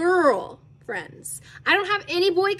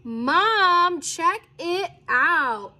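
higher, drawn-out call to share; excited calling; 4 semitones higher, much slower than usual. Acted. Girl shows mom the mountain view, excited. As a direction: call out slowly with wonder.

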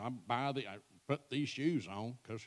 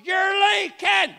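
loud, much higher, slow shout; hollering wife; 16 dB louder, much slower than usual. Retold or imitated. Wife hollering at her husband about his mask, loud. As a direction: yell it to wake him. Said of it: "she hollers".

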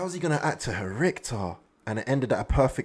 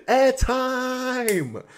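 high, drawn-out airtime in disbelief; stunned, squeaky airtime; incredulous airtime; 10 semitones higher than usual. Real, not acted. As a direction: repeat it like you can't believe it. Repeating the line in disbelief.

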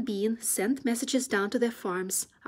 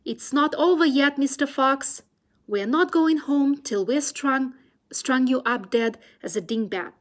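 loud higher threatening shout; 4 semitones higher, 7 dB louder than usual. Acted. Bean threatens the fox down the hole, loud shout. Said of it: "shouting down the hole".

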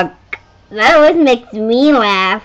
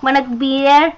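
ordinary talk

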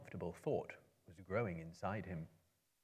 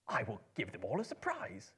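sly thought; 3 dB quieter than usual. Acted. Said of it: "he thought".

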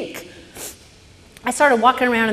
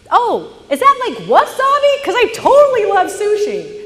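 high, loud airhead voice; shrill-free giddy mock airhead; ditzy valley girl; 12 semitones higher, 8 dB louder than usual. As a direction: play the clueless teen, way up high and bright-eyed. Clueless young self voiced.

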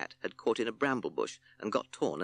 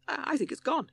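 flustered Captain Beaky, much higher; high worried bird voice; 3 dB louder than usual. Acted. Captain Beaky peers into his hat, high and worried. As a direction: stammer it up high and fretful.

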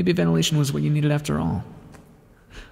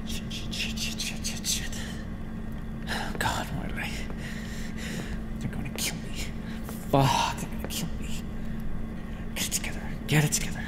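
hushed, frantic muttering to oneself; barely voiced, breathy panic; panicked whisper; 9 dB quieter than usual. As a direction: whisper in dread, fighting to pull yourself together. Panicking alone after the confrontation.

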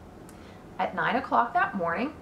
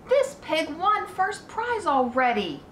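put-on excited boy; 8 semitones higher, 3 dB louder, slower than usual. A narrator's reading. Avery shows the blue tag.

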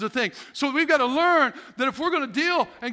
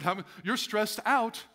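ordinary talk, nothing marked

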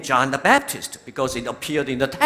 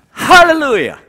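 high, very loud hallelujah; big, high rallying cry; full shout; 14 semitones higher, 15 dB louder than usual. Shown as a demonstration. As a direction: shout it out for the congregation. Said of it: "you've got to shout though".

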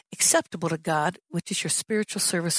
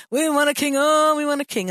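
mock whiny chant, higher and louder; whining crowd; 7 semitones higher, 5 dB louder than usual. Preacher mocks Israel demanding a king, whiny high chant. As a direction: nag like spoilt children.

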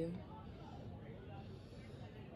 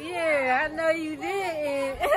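mock disbelief; 8 semitones higher, much slower than usual. Cousin plugs her shop in vlog.

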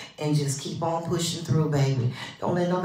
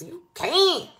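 squeaky sweet plea; 10 semitones higher than usual. Acted. Begging viewers for donations.

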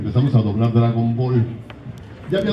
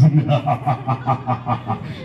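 Freezer's chuckle; 4 dB quieter than usual. Acted. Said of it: "Dice así".

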